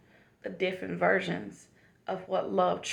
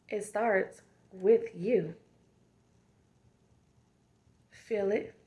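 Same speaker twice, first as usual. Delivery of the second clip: motivational affirmation. Acted. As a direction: speak each line like a loving reminder. Affirmation talk to the viewers.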